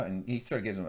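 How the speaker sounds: normal talking voice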